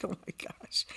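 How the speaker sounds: breathy, hushed "oh my gosh"; a soft, voiceless gasp of realization; whispered dismay. Retold or imitated. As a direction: whisper it under your breath, stunned. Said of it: "I'm like".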